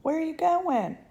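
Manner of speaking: high, loud calling after him; mother calling after son up the pipe, high and loud; frantic calling. Acted. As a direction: call him back in panic.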